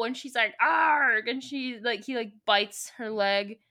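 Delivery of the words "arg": high, loud "arg"; spelt-out comic growl of effort; mock fighting cry. Woman in a struggle, loud mock cry.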